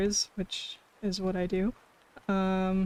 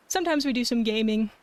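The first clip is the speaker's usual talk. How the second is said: normal talking voice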